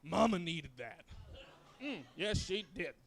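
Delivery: slow, loud, indulgent sigh of pleasure; satisfied mama voice. Acted. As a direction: savour it after a big meal. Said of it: "I'm just like".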